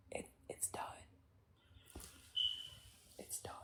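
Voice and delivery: barely audible, voiceless whisper; whispered finality. Real, not acted. Streamer closing the subject of their parents, whispering. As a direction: let it fade into a tiny whisper. Repeats it under the breath.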